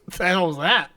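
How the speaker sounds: ordinary talk, nothing marked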